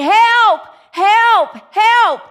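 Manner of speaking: loud, high cries for help; yelling for help. Acted. Hanging hiker calls to anyone for help, yelling. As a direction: yell each word out long, high and loud. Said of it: "So he starts yelling".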